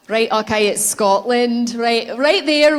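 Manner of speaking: loud, slow put-on Scottish accent; hammed-up Scottish brogue, drawn out. Speaker hams up Scotland for the audience, in a Scottish accent. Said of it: "We've got some fantastic Scottish accents".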